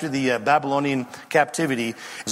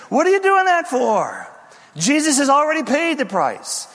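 high, wailing protest; heckling cry; 10 semitones higher, 3 dB louder than usual. Acted. Two witnesses interrupting temple oblations, heckling cry. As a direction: call out in disbelief at the worshippers.

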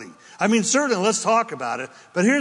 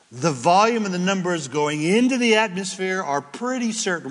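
normal talking voice

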